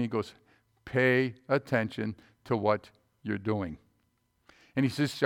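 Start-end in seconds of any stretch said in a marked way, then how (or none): 0.8-3.8 slow, low, softer word-by-word quote; spaced out and subdued; dutiful recital. Retold or imitated. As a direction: imitate a boy reciting a drilled rule. Boy recites the repeated instruction.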